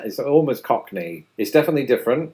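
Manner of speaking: usual speaking voice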